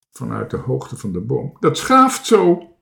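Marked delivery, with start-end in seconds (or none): 1.6-2.7 slow, high, loud whine; whining. A narrator's reading. Toontje complains from high in the tree, slow wail. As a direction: wail it drawn out like a frightened child.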